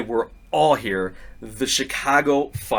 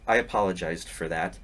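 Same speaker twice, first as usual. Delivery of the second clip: mock dismissive; 7 semitones lower, 6 dB quieter than usual. Parent's dismissive reply.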